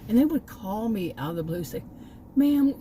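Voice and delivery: normal talking voice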